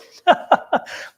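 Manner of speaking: short breathy burst of laughter; high airy laugh; real laugh. Real, not acted. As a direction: let a quick real laugh escape. Host laughs after her remark.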